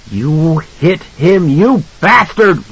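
high, drawn-out accusation; outraged cry. A narrator's reading. Mother accuses husband who hit son, high outraged cry. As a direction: cry out at him, slow and high. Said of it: "Wendy cried".

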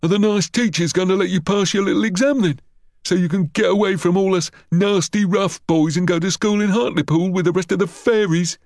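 high-pitched mocking schoolboy; high, goading playground mockery; taunting bully. A narrator's reading. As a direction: jeer at him for the crowd. Bully mocks Harry before crowd.

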